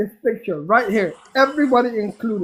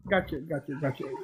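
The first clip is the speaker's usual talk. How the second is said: quiet; 6 semitones lower, 10 dB quieter than usual. Real, not acted. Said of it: "I will stop".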